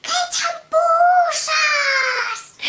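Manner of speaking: high, loud yell from afar; yelling up from the top of the escalator; shouted question. Retold or imitated. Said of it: "me gritó".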